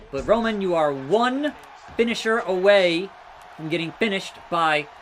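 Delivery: ordinary talk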